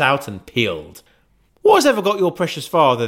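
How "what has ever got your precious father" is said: high, fast fussing mother; a bustling mother fretting over lateness; Mrs Cratchit. Acted. Mrs Cratchit voiced in story.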